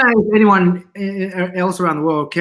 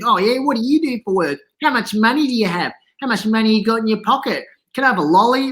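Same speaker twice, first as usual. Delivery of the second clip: nosy kid's higher-pitched, hammed-up Aussie accent; over-the-top Australian accent; 5 semitones higher than usual. Small kid quizzes passing strangers, exaggerated Australian accent. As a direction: fire questions in a broad mock Australian accent. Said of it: "this really over-the-top Australian accent".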